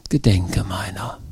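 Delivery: whispered plea, slow and breathless. Shown as a demonstration. Preacher whispers Josef's plea to the congregation, whispering. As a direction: breathe it out as a quiet echo.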